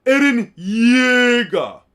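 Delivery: loud, slow, stretched-out excited shout; slow and loud, thrilled to recognise him; excited drawn-out call. Real, not acted. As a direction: belt the name out like you just spotted him.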